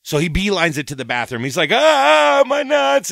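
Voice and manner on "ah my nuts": very high, much louder yell; loud high anguished cry; pained yell. Retold or imitated. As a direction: howl from burning pain. Doug's burning groin.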